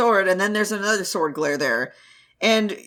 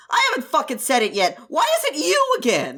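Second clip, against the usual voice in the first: angry; 6 semitones higher, faster than usual. Acted. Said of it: "he's mad as fuck".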